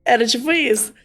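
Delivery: ordinary talk, nothing marked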